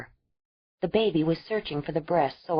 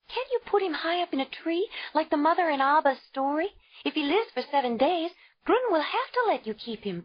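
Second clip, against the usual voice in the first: put-on much higher child's voice, begging; a scared child pleading; frightened little girl; 11 semitones higher than usual. A narrator's reading. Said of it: "Uba begged".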